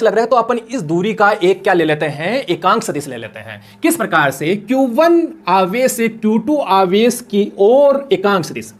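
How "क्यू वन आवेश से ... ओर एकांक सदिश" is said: slow dictation. Shown as a demonstration. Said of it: "देखिए ध्यान से समझिए".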